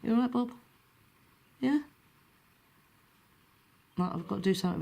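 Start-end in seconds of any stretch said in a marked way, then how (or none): 0.0-1.8 higher coaxing check-in; pet talk voice. Real, not acted. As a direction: gently coax the bird to answer, pitched up.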